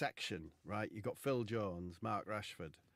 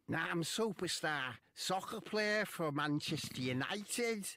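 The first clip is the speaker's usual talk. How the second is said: footballer's voice; 8 semitones higher, 3 dB louder, slower than usual. Retold or imitated.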